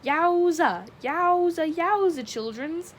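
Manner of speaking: high, loud showman's call. A narrator's reading. Richie cups his hands to his mouth, hollering bandleader impression. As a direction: holler it like an old bandleader, vowels stretched. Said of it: "Bernie voice".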